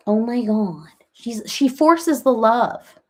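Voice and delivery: ordinary delivery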